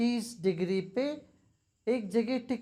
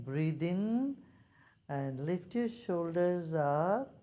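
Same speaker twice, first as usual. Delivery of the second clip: slow, measured meditation instructions with long pauses; guided meditation; slower than usual. Real, not acted. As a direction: guide the group through breathing, unhurried. Leads a meditation.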